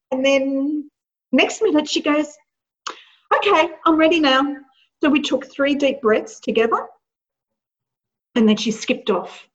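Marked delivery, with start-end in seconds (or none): 3.4-4.4 imitating daughter, brisk. Daughter voiced, ready.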